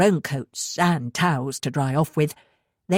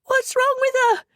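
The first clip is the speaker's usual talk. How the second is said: high, panicked question; a frightened girl's rising plea; shrill and scared. A narrator's reading. Anna asking frantically about her collapsed mother. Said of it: "Her voice sounded shrill".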